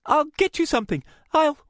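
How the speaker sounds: high, loud, frantic babble; frantic high-pitched reassurance; wild panic. A narrator's reading. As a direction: scramble to help, voice high with panic. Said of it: "Rachel looked around wildly".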